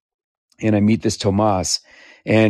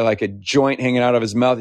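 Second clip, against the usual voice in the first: normal talking voice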